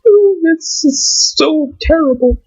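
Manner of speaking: high, slow, drawn-out fake sympathy; sarcastic mock pity. Ranting at people who fear losing jobs, mock pity. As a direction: pretend to be heartbroken, slowly and high.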